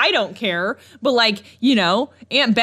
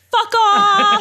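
much higher, louder shouted kiss-off; Mother Nature yelling; 11 semitones higher, 6 dB louder than usual. Pretend. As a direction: yell it as an angry Mother Nature. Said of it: "even Mother Nature is like".

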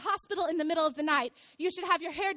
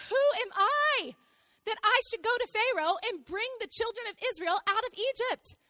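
high-pitched, disbelieving question; incredulous Moses; 4 semitones higher than usual. Acted. Moses doubting himself before God, incredulous. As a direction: protest your unfitness, pitched up.

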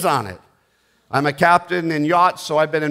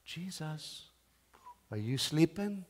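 soft, hushed tiptoe question; a mock timid nudge; gentle whisper; 15 dB quieter than usual. Disciples politely waking Jesus, hushed and soft. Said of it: "kinda went".

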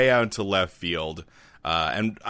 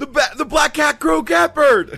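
very high, loud cartoon squeal; squeaky high voice; 8 dB louder than usual. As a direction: shriek it up high.

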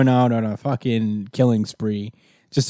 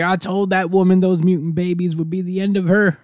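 higher, smug villain gloat; high gloating scientist; 7 semitones higher than usual. Retold or imitated. Scientist gloating about Nanny, high put-on voice.

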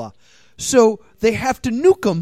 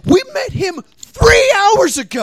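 high, loud, slow outburst; hammed-up yell, stretched out; indignant mock shout; 13 semitones higher, 7 dB louder than usual. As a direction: holler it in comic outrage. Acts outraged objection to the hero.

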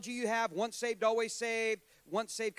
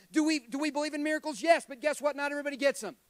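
higher, louder preacher's burst; emphatic preaching; 6 semitones higher, 6 dB louder than usual. Real, not acted. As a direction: fire out question and answer forcefully. Preacher rises to forceful question and answer.

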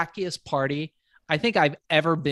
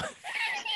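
laugh; 9 dB quieter than usual. Real, not acted. Laughing at his own story.

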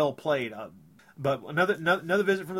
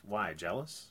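teasing; 7 semitones lower, 10 dB quieter, slower than usual. Retold or imitated. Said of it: "he's kinda mocking her".

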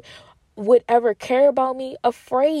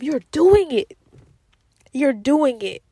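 emphatic encouragement; 3 semitones higher than usual. Real, not acted. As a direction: lift the listener with a cheer.

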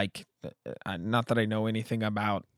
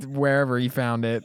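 normal talking voice

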